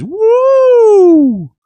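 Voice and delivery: very high, loud whooping Woo; joyful yell of celebration; excited whoop. Retold or imitated. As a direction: let out a shrill-free falsetto whoop of joy. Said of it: "Murray says".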